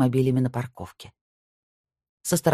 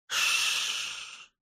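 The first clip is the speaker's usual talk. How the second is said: soft unvoiced shush; soothing shush; 10 dB quieter than usual. A narrator's reading. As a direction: hush her gently. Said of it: "негромко проговорил".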